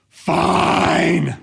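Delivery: exaggerated grudging compliance; grumbling whine. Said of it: "Obedience with an eye roll or grumbling or whining".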